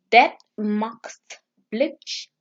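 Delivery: dat maakt blij very slowly; slow Dutch. Shown as a demonstration. Teaching viewers Dutch, very slow. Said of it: "Dutch is".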